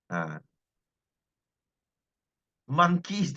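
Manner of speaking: ordinary delivery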